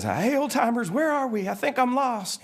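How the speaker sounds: very high, lost tow truck; Mater. Acted. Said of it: "And Mater says".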